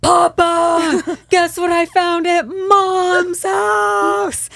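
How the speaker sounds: put-on slow, high, loud giggling child; excited kid. Son on the phone teasing his dad, high and slow. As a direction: tease it out like a kid.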